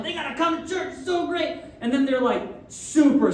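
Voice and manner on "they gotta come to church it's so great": imitating an eager minister, much higher; mock eager. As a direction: gush like an overzealous pastor. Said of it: "and I'm like".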